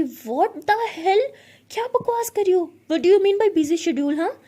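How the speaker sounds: loud, high outraged burst. Acted. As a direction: blow up at her nonsense. Ishani outraged at reply. (भड़ककर चिल्लाना)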